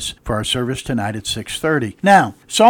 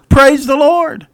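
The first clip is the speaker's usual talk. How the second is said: high, loud exclamation after a laugh; laugh, then cry out in delight; laughing praise; 11 semitones higher, 8 dB louder, slower than usual. Real, not acted. Preacher laughs at his punchline.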